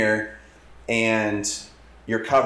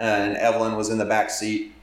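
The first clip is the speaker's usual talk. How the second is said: ordinary talk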